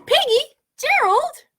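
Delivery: high, loud, thrilled calling of a name; super excited. Acted. Gerald spots Piggy and calls out, super excited. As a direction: burst out with joy at seeing a friend. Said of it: "He's super excited".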